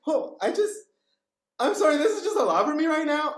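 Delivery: high, loud imitation of someone about to cry; mock tearful. Student overwhelmed by the speaker, mock tearful. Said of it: "they would start crying".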